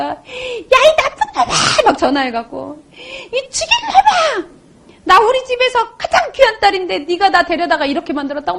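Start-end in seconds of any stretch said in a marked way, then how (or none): 0.0-1.5 high, drawn-out mock wail; retold yelling. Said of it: "막 고래고래 소리를 지르고". 2.9-8.6 retold yelling. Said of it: "막 고래고래 소리를 지르고".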